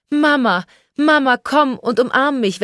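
hoher, lauter Freudenruf nach der Mutter; freudiges Rufen. A narrator's reading. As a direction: ruf freudig und deutlich höher nach ihr. Tochter ruft bei Heimkehr die Mutter. (freudig rufend)

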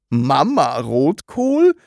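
slow, questioning echo with rising end; bewildered, drawn-out question; puzzled repetition. A narrator's reading. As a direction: repeat it slowly in baffled disbelief. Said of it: "wiederholte Herr Taschenbier".

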